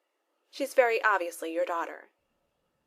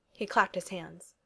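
usual speaking voice

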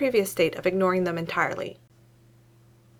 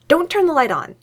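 high, louder urgent warning; 9 semitones higher, 7 dB louder than usual. A narrator's reading. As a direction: stop him fast, don't scare her. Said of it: "he warns".

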